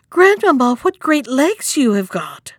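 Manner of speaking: put-on high little-girl astonishment; greatly amazed. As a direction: exclaim in wonder, leaping up on the first word. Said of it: "being greatly amazed".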